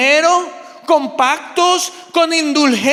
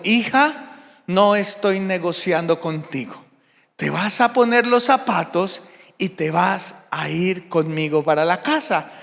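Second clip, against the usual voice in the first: lower, softer firm mother's voice; 6 semitones lower, 4 dB quieter than usual. Retold or imitated. Mother telling her daughter they are going home, low and firm. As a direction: settle it calmly like a mother who won't budge. Mother firmly ends negotiation.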